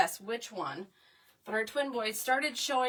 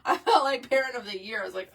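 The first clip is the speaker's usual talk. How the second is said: ordinary talk, nothing marked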